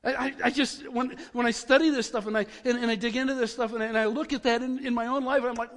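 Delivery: ordinary talk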